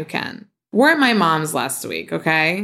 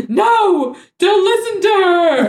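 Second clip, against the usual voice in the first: much higher mock mom voice; imitating mom; 13 semitones higher, 3 dB louder, slower than usual. Host mocking her mom siding with sister, high caricature. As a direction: squeak it out as a shrill caricature of mom. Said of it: "And my mom is like".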